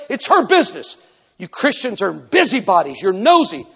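loud, high furious rant; angry ranting man. Retold or imitated. Preacher imitating the Harvard man ranting at Christians, loud and angry. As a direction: rail at them like he's raging. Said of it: "He took off".

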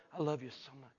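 low, very soft, adoring murmur; tender mock affection. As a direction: play the adoring disciple, hushed and low. John's tender words to Jesus, voiced.

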